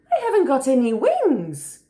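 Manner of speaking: high, loud little wallaby; protesting Joey. A narrator's reading. Joey telling Kookaburra he has no wings, protesting.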